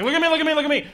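high, whiny show-off voice; mock a guy begging for attention; attention seeker. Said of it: "one of those kind of guys like".

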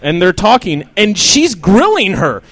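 ordinary delivery

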